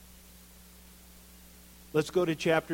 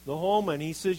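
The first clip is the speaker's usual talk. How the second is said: ordinary delivery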